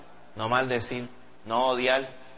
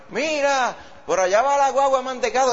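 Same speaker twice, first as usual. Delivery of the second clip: high, eager shout of discovery; excited child; 11 semitones higher, 3 dB louder than usual. Retold or imitated. A child spots the ice cream truck, high and excited. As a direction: point it out like a thrilled kid.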